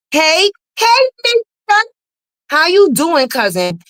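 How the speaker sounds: high, loud sing-song hello; a taunting, over-sweet hello; mock greeting. Host mockingly greets the rival, high and loud.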